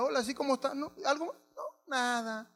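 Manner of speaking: soft, lightly imitated pituca small talk; mock pituca. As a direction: play the posh copycat, quietly. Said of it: "de la gente que es pituca". (imitando en voz baja a un pituco)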